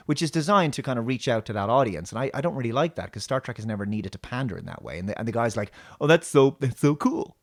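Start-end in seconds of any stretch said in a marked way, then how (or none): 6.0-7.4 higher, louder, mock effeminate gush; effeminate hipster. Mocking the boyfriend's gushing, higher, louder. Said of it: "And he's really effeminate".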